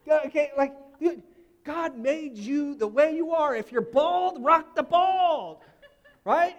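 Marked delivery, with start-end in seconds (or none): 4.4-6.6 laughing through a loud urging. Real, not acted. Pastor laughing as he urges the congregation. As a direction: chuckle while you push the point.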